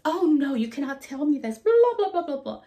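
high, loud indignant protest; hammed-up imitation of an outraged customer; outraged mom. Retelling outraged rich mom.

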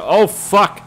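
high, loud burst of alarm; startled curse. Real, not acted. Gamer suddenly under fire, startled loud curse. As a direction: curse as if caught off guard.

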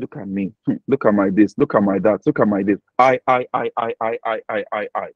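low, monotone self-important chant; mock boasting. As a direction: imitate someone full of himself. Voicing a self-centred boaster.

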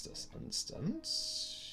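very high, slow, whispered. Pretend. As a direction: squeak it out in a whisper. Repeating phrase playfully.

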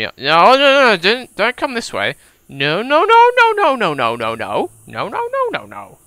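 high, loud frantic no's; panicked pleading. Real, not acted. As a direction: beg the zombie to stay away. Zombie coming toward player.

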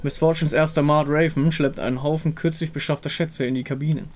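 plain reading voice

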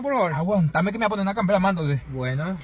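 ordinary delivery

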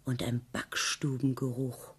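ordinary delivery

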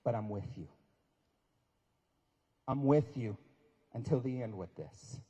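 zacht, langzaam en lager, vol liefde; tender reassurance. Retold or imitated. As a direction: geruststellend fluisteren als de baas. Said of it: "he said the thing".

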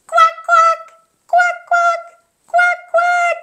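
high, lively paired quacks; duck quack. Pretend. Playing the yellow duck for children, high quacking. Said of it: "It's a yellow duck!".

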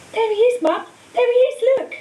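put-on a young girl squealing as she points; excited little girl. A narrator's reading. Excited little girl spots Harry.

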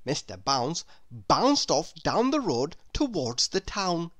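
ordinary delivery

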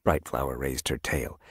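usual speaking voice

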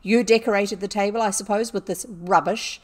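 loud, scornful accusation; fierce scolding. A narrator's reading. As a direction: rail at the culprit in anger. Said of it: "she cried".

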